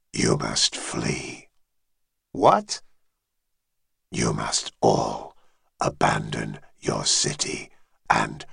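very low, distant dragon voice; deep dragon voice. Acted. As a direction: speak as an ancient dragon, far below. Dragon warns them to flee.

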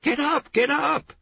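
very high, loud urgent yell; shouted order. A narrator's reading. Woman dragging a man out of bed, shouting. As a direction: yell to get them moving now.

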